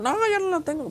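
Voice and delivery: put-on kid protesting. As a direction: deny it like a small child. El hermanito contesta. (el hermanito lo niega con voz aguda de niño)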